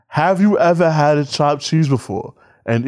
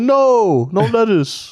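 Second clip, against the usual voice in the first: raised, firm no lettuce; insistent correction; 9 semitones higher, much slower than usual. Retold or imitated. Host retelling his own insistent reply to the deli worker. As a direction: put your foot down on no lettuce. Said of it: "I said".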